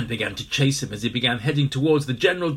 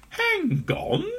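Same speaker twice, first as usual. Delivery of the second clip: startled high voice. Acted. Startled character in story.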